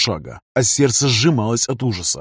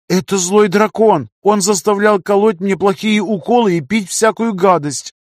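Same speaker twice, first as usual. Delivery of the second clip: put-on frightened little girl; 8 semitones higher than usual. A narrator's reading. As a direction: complain like a scared little girl. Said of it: "сказала Люся".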